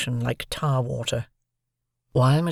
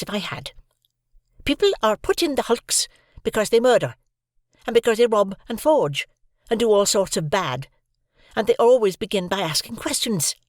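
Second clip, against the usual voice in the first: scolding sister; 7 semitones higher than usual. A narrator's reading. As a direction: tell the boy off firmly. Sister scolds and sends to bed.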